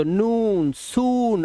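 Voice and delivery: नून, सून with the oo held long; नून, सून as "noon, soon", long oo; long oo vowel. Shown as a demonstration. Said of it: "इसमें long vowel sound है".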